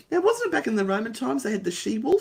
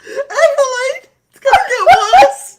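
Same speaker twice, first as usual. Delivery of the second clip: laughing; 12 dB louder than usual. Real, not acted. Fit of laughter mid reading.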